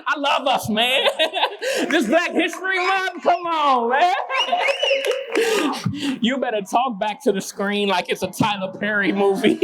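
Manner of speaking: high, delighted voice full of real laughter; laughing banter. Real, not acted. Poet teases audience for talking back, cracking up laughing. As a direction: tease them fondly through big laughs.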